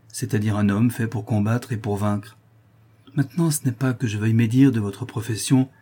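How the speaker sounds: usual speaking voice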